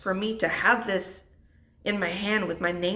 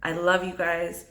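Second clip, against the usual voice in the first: ordinary delivery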